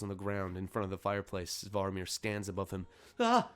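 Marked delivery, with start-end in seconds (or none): 3.2-3.5 very high, loud strained cry; distressed old elf. Acted. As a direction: cry out as a stunned old man on the ground. Punched old elf cries out.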